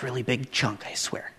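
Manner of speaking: a quick whispered promise; whispered aside. Real, not acted. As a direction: drop to a whisper for the joke.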